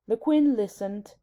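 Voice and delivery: usual speaking voice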